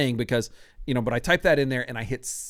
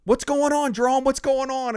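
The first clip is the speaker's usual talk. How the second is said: alarmed colleague; 10 semitones higher, 4 dB louder than usual. Retold or imitated. Colleagues on speakerphone asking.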